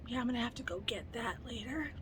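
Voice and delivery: breathy whispered aside; whispered to herself about fetching the purse. Real, not acted. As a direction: whisper it like a confession.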